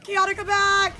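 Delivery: loud, high call to a teammate; urgent loud callout. Real, not acted. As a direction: shout across the fight. Calling teammate back in fight.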